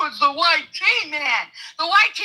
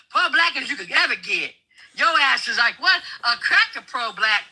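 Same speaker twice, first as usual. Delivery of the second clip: ordinary talk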